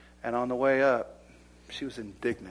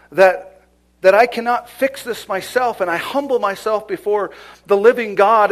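loud, high, forceful preaching; preaching at full force; 8 semitones higher, 15 dB louder than usual. Acted. Preacher pressing the church on humility, loud and forceful. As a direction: thunder it out to the congregation.

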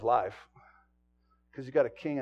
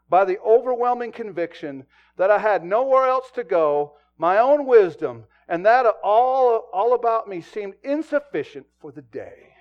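loud, slowed, weighty reading; preached quote; 7 semitones higher, 14 dB louder than usual. Acted. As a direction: lean hard on every phrase.

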